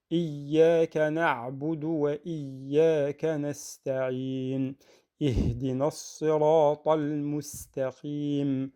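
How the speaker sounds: measured, sustained tajwid chanting; Quran recitation. Shown as a demonstration. Teacher redoing the surah end to end, sustained recitation. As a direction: recite fully, drawing out each long vowel.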